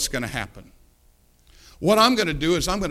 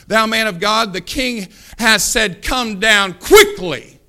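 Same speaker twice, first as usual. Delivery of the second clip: loud, raised, haughty summons; even more attitude; 3 semitones higher, 7 dB louder, slower than usual. Acted. Second captain summoning the man of God, haughty. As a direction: lean slowly and loudly on every phrase, full of attitude. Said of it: "He's got even more of an attitude".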